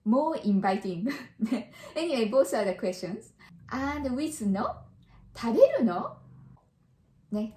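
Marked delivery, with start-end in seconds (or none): 5.4-6.2 食べるの? with the pitch rising on の; rising question. Shown as a demonstration. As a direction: lift 食べるの? up at the end like a surprised question. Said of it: "Rising intonation".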